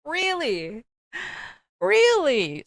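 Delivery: mock disbelief, voice climbing; can't believe what he just asked; disbelieving really. Reacting to request for nudes.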